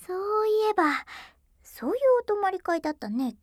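loud, high, quick recall; sudden realization. Acted. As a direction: remember it all at once. Suddenly remembering sleepover.

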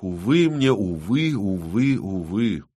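repeated alas, sinking with each one; sighing lament. A narrator's reading. Artist bemoaning the delay to his client, sighing. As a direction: sigh it over and over, letting it fall away. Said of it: "вздохнул Генрих".

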